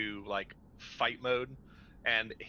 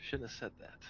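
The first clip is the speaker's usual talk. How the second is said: muttered under breath; 9 dB quieter than usual. Acted. Jack mutters to himself in character.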